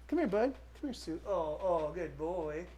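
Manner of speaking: quiet, tender dog talk; soft praise to a dog. Real, not acted. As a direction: coo softly at the dog.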